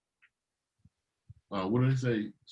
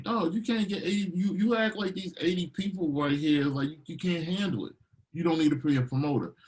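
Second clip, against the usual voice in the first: high, exasperated lecture; scolding; 7 semitones higher than usual. Retold or imitated. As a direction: tell him off, fed up. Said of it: "I'm like".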